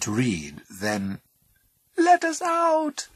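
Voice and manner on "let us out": creepy little voice. Acted. Voice from the chest.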